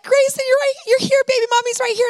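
put-on light up as your baby finally looks back at you; relieved mother. Mother seeing her son come back, high excited relief.

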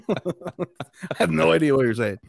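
said through a laugh. Real, not acted. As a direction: laugh while talking. Joking about not speaking Spanish.

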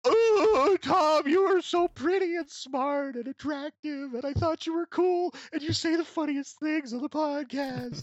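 very high, slow, gushing falsetto; swooning admirer. Pretend. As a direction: gush like a heartbroken fan over a corpse. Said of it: "fall to their knees and go".

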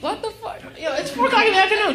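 high, quick disbelief; incredulous. Acted. Resident questions a four o'clock party offer, incredulous.

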